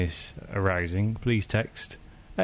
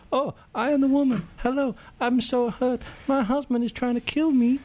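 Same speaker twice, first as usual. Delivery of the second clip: falsetto woman; 14 semitones higher than usual. Acted. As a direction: squeak it out like a hurt lady in a melodrama. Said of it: "we've got to do a girl".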